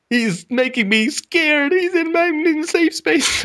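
high, drawn-out mock whine; mock scared whiner. Mocking those frightened by an assertive man, high mock-scared voice. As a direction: whimper slowly and high, hammed-up.

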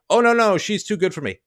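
high, loud mocking whine of his excuse; mocking quote. Host mocks the boyfriend's excuse to the caller, in a high loud sneer. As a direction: sneer out the boyfriend's fake modesty.

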